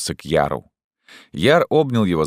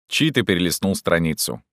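plain reading voice

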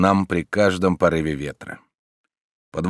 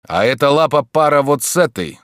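higher and louder, cocky reply; stranger's retort; 8 semitones higher, 6 dB louder than usual. A narrator's reading. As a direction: answer with a grin in the voice. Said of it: "отвечал голос". (дерзкий ответ незнакомца)

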